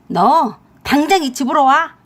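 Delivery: loud, furious mother-in-law's order; angry shout down the phone; fierce command. Acted. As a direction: order the son home at once. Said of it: "시어머니 앙칼진 목소리를 듣더니".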